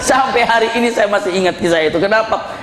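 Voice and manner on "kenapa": ordinary talk, nothing marked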